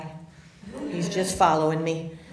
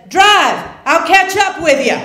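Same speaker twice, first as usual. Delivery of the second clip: high, loud called-out order; retold shouted instruction, pitched up; urgent command; 9 semitones higher, 10 dB louder than usual. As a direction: call out to the driver to get going. Retold command from husband outside car.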